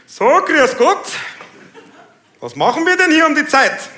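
high, loud officious policeman; young bullish cop. Acted. New cop at a night traffic check, officious loud cop voice. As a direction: lean loudly into the driver like a new sheriff. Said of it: "zwei neue Sheriffs in der Stadt".

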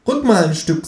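high-pitched kangaroo; kangaroo character voice. A narrator's reading. Kangaroo correcting itself to the narrator, high cartoon voice. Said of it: "sagt es".

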